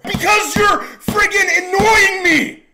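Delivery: high, loud, slow yelling; furious shout. Retold or imitated. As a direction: blow up at them. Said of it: "quarrel with servers".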